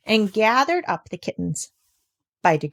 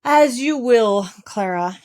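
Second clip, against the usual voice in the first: slow, louder, huffy concession; displeased; 2 semitones higher, 5 dB louder, slower than usual. A narrator's reading. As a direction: give in with bad grace. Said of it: "said with some displeasure".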